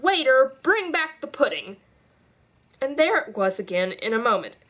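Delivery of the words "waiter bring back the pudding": calling out. A narrator's reading. Alice calls the waiter back with the pudding. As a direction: call across the table for the pudding. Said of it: "she called out".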